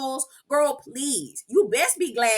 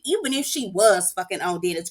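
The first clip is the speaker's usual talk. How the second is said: ordinary talk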